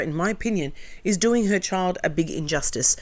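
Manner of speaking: ordinary delivery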